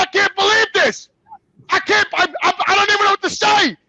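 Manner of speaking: high, loud, sputtering disbelief; retold anguished yell; overwhelmed outrage. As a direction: act out being too upset to finish, voice way up. Retold outburst at mother.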